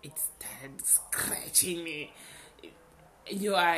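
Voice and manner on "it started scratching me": breathy slow whisper. Real, not acted. Confessing the voice won't leave her, slow whisper.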